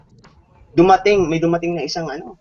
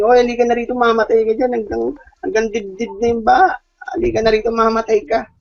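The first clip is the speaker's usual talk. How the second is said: raised, higher voice urging him aboard; urgent call; 6 semitones higher than usual. Retold or imitated. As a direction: jet ski rider calling out, pitched up. Jet ski rider urges him.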